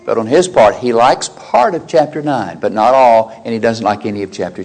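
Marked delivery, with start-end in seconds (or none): none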